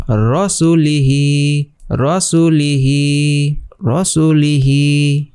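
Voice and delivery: রসুলিহি drawn out slowly, the li held one beat; one alif stretch. Shown as a demonstration. Teacher repeating রসুলিহি slowly for students. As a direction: lean on the long i in রসুলিহি, very slowly. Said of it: "এক আলিফ টেনে পড়তে হবে এখানে".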